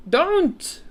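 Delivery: high, loud yelp; poor giant crying out; pained cry. A narrator's reading. BFG begging Sophie to stop, loud cry. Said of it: "cried the poor B F G".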